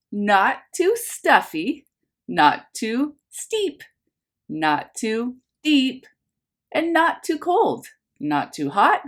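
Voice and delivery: lively picture-book narration for a class; reading to children. A narrator's reading. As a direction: read it like story time for little ones. Teacher reads picture book aloud.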